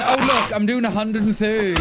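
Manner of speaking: hammed-up boast at the top of your voice; exaggerated yelled self-impression; mock shouted quote. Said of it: "that's exactly what I said".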